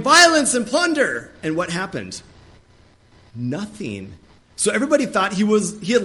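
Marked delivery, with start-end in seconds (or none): 0.0-1.3 very high, loud cry of alarm; frantic shout. Retold or imitated. Preacher acting Jeremiah running about the streets, shouting high and loud. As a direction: run around yelling the warning. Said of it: "Jeremiah's running around going".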